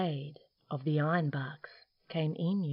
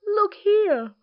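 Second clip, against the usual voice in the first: yawning koala; 7 dB louder than usual. Acted. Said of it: "he yawned".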